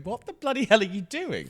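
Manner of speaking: a touch of a director losing patience at rehearsal; mock exasperated director. Director Lawrence snapping at an actor's accent, mock exasperated. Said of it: "and Lawrence going".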